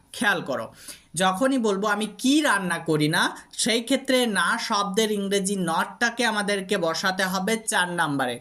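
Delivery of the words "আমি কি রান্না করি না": কি hit hard, falling to the end; heavy emphasis on কি, the rest trailing down; stress on কি. Shown as a demonstration. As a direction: punch কি as a "what" question. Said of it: "যখন আমরা কি শব্দের উপর জোর দেব".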